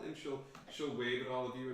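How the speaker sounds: normal talking voice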